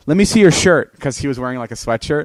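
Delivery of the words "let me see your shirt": louder, higher commanding demand; loud mock interrogation. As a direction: order him like a cop. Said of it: "Jonah goes to him and goes".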